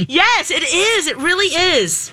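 bubbly cheerleader cheer. Pretend. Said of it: "I was trying to sound like a cheerleader".

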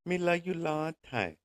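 high, breathy-free mock squeak; lightly hammed movie-line impression; mock hooker quote. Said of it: "using that Jedi mind trick".

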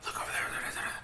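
hushed crowd whisper; excited audience murmuring under their breath. Retold or imitated. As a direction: whisper it like gossip spreading through a room. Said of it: "everybody starts whispering".

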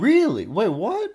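high, shocked "Really?" with falling tune; stunned disbelief; surprised. Real, not acted. Friend reacts to shocking news.

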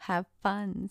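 usual speaking voice

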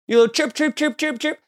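high mocking chirp chirp; mocking North Korea as a chirping bird. Real, not acted. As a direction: tease like a little bird.

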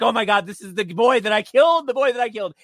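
high, loud horrified realization; panicked gasp. Retold or imitated. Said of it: "and she's like".

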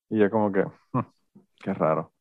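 ordinary delivery

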